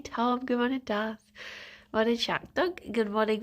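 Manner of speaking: usual speaking voice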